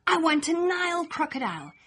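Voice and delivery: usual speaking voice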